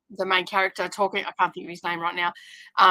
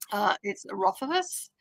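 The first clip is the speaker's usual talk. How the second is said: Rothfuss said slowly, each syllable clear; said slowly and clearly; 5 dB quieter, slower than usual. Shown as a demonstration. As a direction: repeat Rothfuss slowly for the viewer. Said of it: "I don't understand what you're saying".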